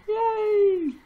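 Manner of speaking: high little yay; cheer. Real, not acted. Soaper cheering her gold soap, high happy yay.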